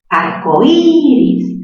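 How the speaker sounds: arcoíris very slow, high stretched Í; stress on Í. Shown as a demonstration. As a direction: hold and lift the í of arcoíris. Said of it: "La cerrada se pronuncia con mayor fuerza". (arcoíris alargando mucho la Í)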